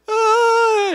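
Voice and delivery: loud, high imitated toddler wail; mock child crying. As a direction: burst into a pretend tantrum cry. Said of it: "Pare de chorar".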